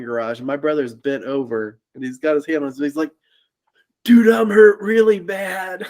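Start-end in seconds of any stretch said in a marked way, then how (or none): 4.0-5.9 retold groan of pain, slow and loud; a mock pained plea, higher and louder; pained brother. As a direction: voice the hurt brother straining. Brother hurt after car hit.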